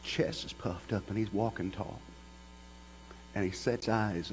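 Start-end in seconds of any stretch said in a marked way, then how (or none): none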